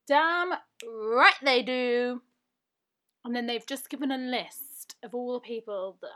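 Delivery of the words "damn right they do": loud, drawn-out hearty agreement; slow, punchy and loud; emphatic agreement. Real, not acted. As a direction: back them up loudly, stretching the words. Emphatic cheer for the doctors' shout out.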